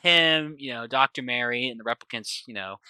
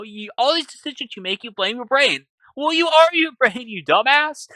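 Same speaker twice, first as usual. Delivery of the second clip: high, loud mock tirade; acted fed-up telling-off; exasperated insult; 9 semitones higher, 5 dB louder than usual. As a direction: build to a scornful outburst with a laugh slipping in. Captive's tirade peaks in insult.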